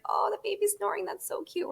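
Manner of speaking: high, sugary mock coo over a snoring baby; gushing baby-talk parody. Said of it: "everyone's like".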